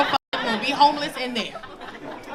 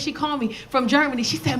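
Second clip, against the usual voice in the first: ordinary talk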